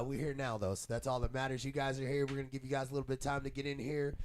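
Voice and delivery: usual speaking voice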